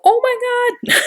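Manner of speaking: squeaky high shocked oh my God; very high hammed-up shock then a laugh; mock shocked gasp. Shown as a demonstration. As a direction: play a reader floored by a twist. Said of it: "I'll kinda pop on and go".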